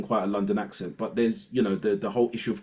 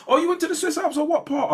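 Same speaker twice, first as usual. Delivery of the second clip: high, eager posh gushing; excited posh voice; 5 dB louder than usual. Retold or imitated. Colleague at an interview gushing in a high posh voice. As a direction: gush like a well-off colleague. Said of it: "it's like".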